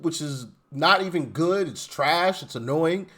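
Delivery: ordinary talk, nothing marked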